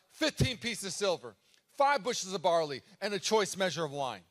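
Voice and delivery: loud triumphant call across the auction; a bold public bid, called out loud; yelling out. Retold or imitated. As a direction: shout the price out proudly. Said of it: "she yells out".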